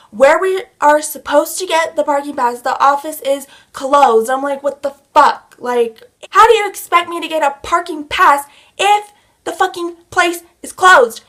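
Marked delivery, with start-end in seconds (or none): 4.6-11.2 exasperated outburst, loud and high; heated. Retold or imitated. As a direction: rage at the absurdity, building higher. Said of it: "I am heated right now".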